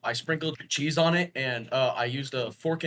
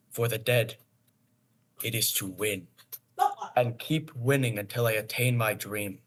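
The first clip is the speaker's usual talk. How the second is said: lightly voiced Griffith, grave and measured; a hint of the Berserk hero's solemn resolve. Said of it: "Do Griffith, do Griffith".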